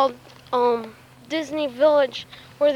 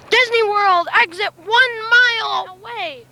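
excited; 9 semitones higher, 5 dB louder than usual. Real, not acted. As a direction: announce it like the best news ever. Kid excitedly reading sign.

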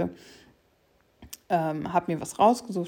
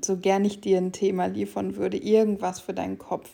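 ordinary delivery

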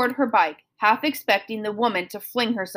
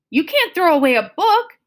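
outburst; 7 semitones higher, 4 dB louder than usual. A narrator's reading. Girl protests book being thrown.

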